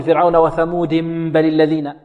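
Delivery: وثمود run into بل as thamudim-bal with a hummed m; wrongly joined nunation. Shown as a demonstration. Teacher imitating a student joining the verses, wrongly joined nunation. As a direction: join وثمود to بل through -dim. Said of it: "أراد أن يصل أصل".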